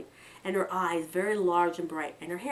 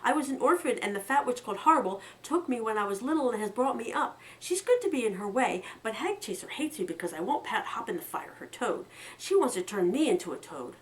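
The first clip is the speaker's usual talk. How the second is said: words tumbling out, fast and louder; breathless rush; 4 semitones higher, 3 dB louder than usual. Acted. Orphan girl confesses her story to travelers, rushed. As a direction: blurt it all out in one breath. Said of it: "her words came in a breathless rush".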